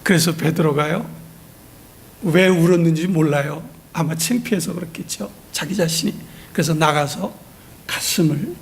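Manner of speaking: normal talking voice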